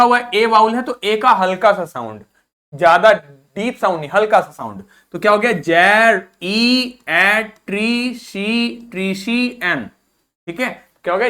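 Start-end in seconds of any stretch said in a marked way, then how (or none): 5.5-9.9 जेर, ई, ए, ट्री, शी, एन split into separate syllables. Shown as a demonstration. Teacher breaks the word down for students.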